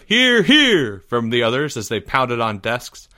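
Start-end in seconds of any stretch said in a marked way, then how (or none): 0.0-1.0 high, loud cry of approval; rousing chorus cheer. A narrator's reading. Club members pounding desks, loud high cheer. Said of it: "to another chorus of".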